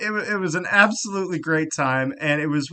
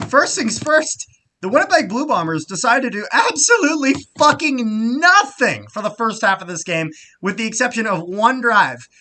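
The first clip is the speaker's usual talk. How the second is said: excited; 7 semitones higher, 6 dB louder than usual. Real, not acted. Excited rant about team.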